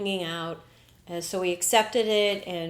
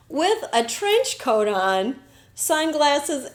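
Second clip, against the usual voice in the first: ordinary talk, nothing marked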